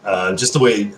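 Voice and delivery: usual speaking voice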